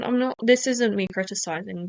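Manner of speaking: ordinary talk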